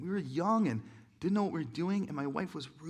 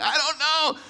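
high, loud, half laughing; a bewildered laugh-cry answer; laughing helplessly; 12 semitones higher, 10 dB louder, slower than usual. Retold or imitated. Answering his amused wife, bewildered laughter. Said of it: "I'm like".

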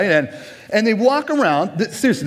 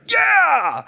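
high shouted cheer; retold cheer; 12 semitones higher than usual. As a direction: whoop like a rude fan at a hockey game. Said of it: "heard one person ... cheer".

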